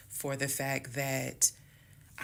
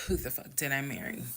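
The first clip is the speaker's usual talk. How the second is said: usual speaking voice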